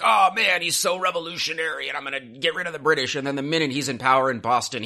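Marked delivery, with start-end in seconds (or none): 0.0-3.1 high, lightly voiced mock Sam Adams. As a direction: puff him up as a swaggering rebel. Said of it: "how he's like".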